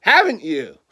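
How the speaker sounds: usual speaking voice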